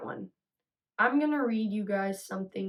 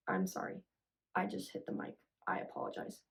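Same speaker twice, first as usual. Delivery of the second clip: quick, soft unvoiced apology; murmuring sorry under breath after hitting the mic; hushed apology; 9 dB quieter, faster than usual. Real, not acted.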